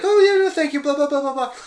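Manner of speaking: very high, gushing prattle; mock bubbly coworker. Imitating a coworker's typed thanks, very high and bubbly. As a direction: chirp it like a living smiley emoji. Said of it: "breathing, smiling emoji".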